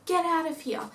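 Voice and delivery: mock-scold the spirit, laughing it off; mock exorcism. Parents teasing scared kids with a fake ghost banishing. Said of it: "they started laughing".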